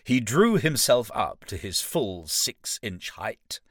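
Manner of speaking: plain reading voice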